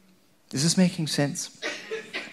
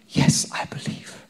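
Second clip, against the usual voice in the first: hushed whisper of faith; whispered yes; 4 dB quieter than usual. Acted. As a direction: breathe the answer like a secret prayer. Voicing a believer's quiet answer.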